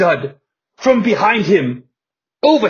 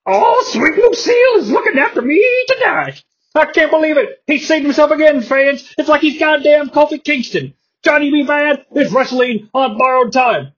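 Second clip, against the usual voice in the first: much higher, amazed ringside commentator; 9 semitones higher than usual. Acted. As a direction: marvel at the comeback. Commentator marvels at Johnny B.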